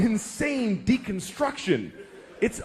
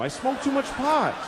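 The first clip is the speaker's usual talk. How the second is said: blurted confession; 7 semitones higher than usual. Retold or imitated. Dad shouting at his questioning daughter, exasperated. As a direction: blurt it out, fed up.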